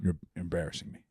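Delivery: a light take on Shane's voice; Shane impression. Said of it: "I can do Shane a little bit".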